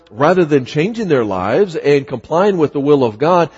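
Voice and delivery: ordinary talk, nothing marked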